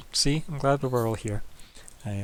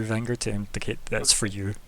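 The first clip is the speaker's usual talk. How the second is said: ordinary delivery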